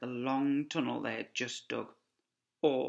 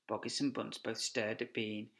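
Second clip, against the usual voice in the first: ordinary delivery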